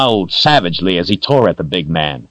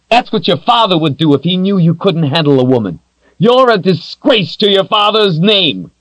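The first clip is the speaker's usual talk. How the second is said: mock his manhood, sneering; defiant captive woman jeering at the demon; goading woman; 11 semitones higher than usual. A narrator's reading. Kalen insults his father.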